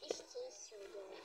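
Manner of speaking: soft unvoiced whisper. Real, not acted. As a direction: breathe it out quietly. Whispered aside about the candy.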